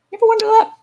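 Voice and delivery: squeaky jab. Real, not acted. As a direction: pop the question up in a squeak. Rhetorical jab at critics.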